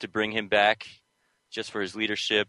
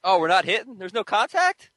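high, louder mock-shocked question; mock surprise; 10 semitones higher, 5 dB louder than usual. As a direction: act scandalized that there's no contact. Co-host mock shock at no tackling.

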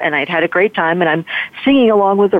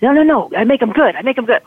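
retold insistent correction; 7 semitones higher, faster than usual. Boy correcting her misunderstanding.

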